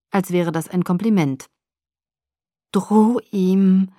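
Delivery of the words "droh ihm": urgent command with a touch of fear; angstverzerrt. A narrator's reading. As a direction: order it tensely, slightly fearful. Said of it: "befahl die geliebte Täuschung mit angstverzerrter Stimme". (leicht angstverzerrter Befehl)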